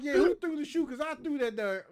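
loud, high challenging yell; the angry teen yelling at the squad over the shoe; defiant shout. Retold or imitated. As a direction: shout back at the whole squad. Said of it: "I was like".

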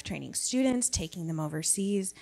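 ordinary talk

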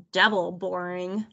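slow, low, unimpressed drawl; bored dismissal. A narrator's reading. Martin rejects watching cartoons on TV, bored and dismissive.

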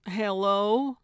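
wary phone greeting, rising; suspicious. A narrator's reading. Gertrude answers an unknown number, suspicious. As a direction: answer as if unsure who is calling. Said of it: "sounding suspicious".

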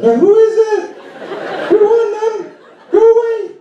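very high, stressed cartoon falsetto; Mickey Mouse. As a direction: squeak it out in a panicked mouse voice. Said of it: "I start sounding like Mickey Mouse".

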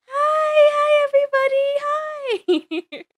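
high, teary squeal of hello; squealed greeting. Acted. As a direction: cry out hi to returning fans. Tearful squealed greeting to fans.

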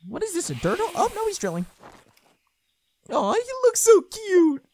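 high squeaky puppet voice; high-pitched cartoon sidekick; excitable puppet. High puppet character reacting.

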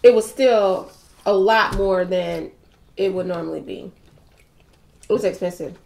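eating between words; talking while chewing. Real, not acted. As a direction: mouth partly full of food. Seen 0: Woman talking holds patty at lips, bites between phrases throughout.

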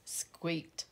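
usual speaking voice

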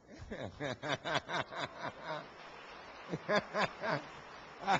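hearty laugh, rolling on; long real laugh. Real, not acted. Pastor laughing at his own joke before the audience, long hearty laugh.

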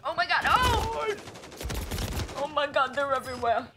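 jackpot winner. Real, not acted. Loot jackpot, excited outburst.

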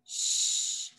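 put-on soft hushed sh, like over a sleeping baby; whispering over a crib. Shown as a demonstration. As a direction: breathe out "Shh" quietly, no voice. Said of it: "Don't wake the baby".

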